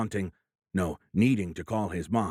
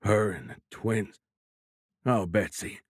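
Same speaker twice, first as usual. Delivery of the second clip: choked grief. A narrator's reading. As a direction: let the name break out of you. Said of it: "in choked words".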